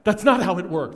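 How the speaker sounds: put-on loud indignant child's retort; indignant seven-year-old. Son scolding his teasing dad, in a loud indignant voice. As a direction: push back hard like an offended seven-year-old. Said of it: "looks up and goes".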